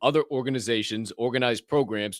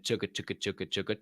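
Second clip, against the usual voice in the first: train chugging noise; 5 semitones lower, 8 dB quieter, faster than usual. Pretend. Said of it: "podcast train leaving the station".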